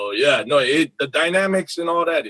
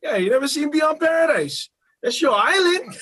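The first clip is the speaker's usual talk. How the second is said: high, teasing quoted voice; the guy calling him out, pitched well up; imitating the guy; 12 semitones higher than usual. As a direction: raise it up high, half laughing. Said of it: "he was like".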